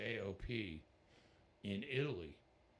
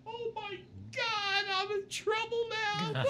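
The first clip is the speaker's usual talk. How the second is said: much higher, slow, comic dread; mock alarm; 7 dB louder than usual. [name] realizing the night will get wild, hammed-up dread. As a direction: play up the panic for laughs. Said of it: "I'm like".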